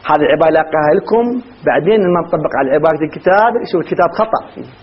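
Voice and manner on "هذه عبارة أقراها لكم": ordinary talk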